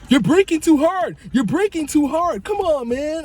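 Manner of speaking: high whiny mock nag; nagging friend. Passenger nagging the driver about braking, high whiny mock. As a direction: whine it high like a backseat driver.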